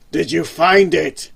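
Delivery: the boss demands an answer again; loud demand. Acted. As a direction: hammer the question home. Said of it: "demanded V".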